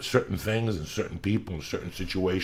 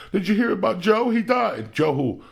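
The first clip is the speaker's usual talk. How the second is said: higher, louder, hammed-up sobbing-friend voice; mock grieving friend; 11 semitones higher, 4 dB louder than usual. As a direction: mimic the dramatic mourner, pitched up. Mocking a friend crying over death.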